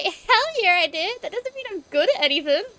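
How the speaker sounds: high, loud boast; loud, emphatic retort, played for laughs; hammed-up proud comeback. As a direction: crow it in mock triumph. Acted boastful comeback, then admission.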